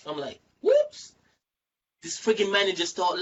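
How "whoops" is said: very high, loud yelp of surprise; a sudden comic yelp; retold startled reaction.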